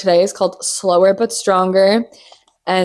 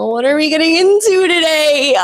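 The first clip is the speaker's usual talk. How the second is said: much higher, loud announcer call; hyped host shout; 9 semitones higher, 3 dB louder than usual. Acted. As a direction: pump up the audience. Host hype line.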